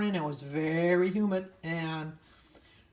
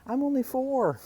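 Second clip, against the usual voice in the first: put-on higher, louder little girl voice; 8 semitones higher, 5 dB louder than usual. Neighbor's little girl talks to her from a toy car, imitated in a high child voice. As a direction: chirp it like a four-year-old girl. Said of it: "She says".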